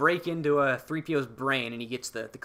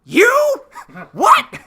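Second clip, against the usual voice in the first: squeaky, much higher and louder mock shock; shocked disbelief; 15 dB louder than usual. Podcaster voicing the crew stunned by the spy reveal, squeaky mock shock. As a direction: squeal in disbelief, breaking into a laugh. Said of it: "And they're like".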